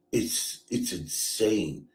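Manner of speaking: ordinary talk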